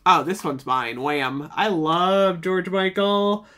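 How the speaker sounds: usual speaking voice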